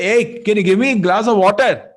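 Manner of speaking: loud, high, rude demand; agitated demand. Retold or imitated. Angry king demanding water from the meditating sage. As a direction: bark it out impatiently.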